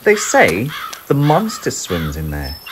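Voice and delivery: ordinary delivery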